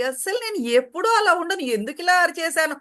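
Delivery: high, quick self-justifying complaint; mock agitated protest, fast and high; acted fretting. Acting someone fretting over an outburst.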